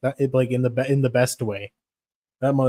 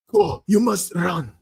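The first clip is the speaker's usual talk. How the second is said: put-on slow, higher, intense accented command; intense fighter quote; 6 semitones higher, 3 dB louder, slower than usual. Fighter in an intense clip urging someone to run. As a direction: order them to run, dead serious. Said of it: "that crazy clip where he's all intense".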